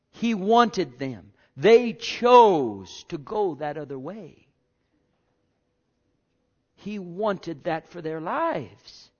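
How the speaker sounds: slow, weighty pulpit declaration; emphatic preaching. Real, not acted. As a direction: stretch the key words with conviction.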